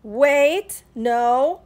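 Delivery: very loud, forceful protest; exasperated loud objection; indignant halt. Acted. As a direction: stop them with loud, stretched words. Angry Iguana halts her friends.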